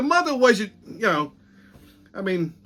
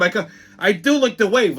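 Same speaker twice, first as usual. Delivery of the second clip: ordinary talk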